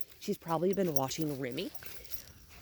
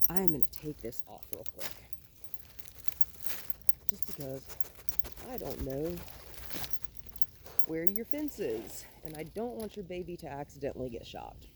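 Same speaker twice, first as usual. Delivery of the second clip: much softer, murmuring close to the dog; 14 dB quieter than usual. Real, not acted. Trainer softly telling the puppy about her collar.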